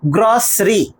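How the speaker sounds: গ্রসারি squeezed into two syllables, gros-ri; corrected pronunciation. Shown as a demonstration. Teacher giving students the correct form, corrected pronunciation. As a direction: drop the middle vowel in গ্রসারি, saying gros-ri. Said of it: "উচ্চারণ হচ্ছে".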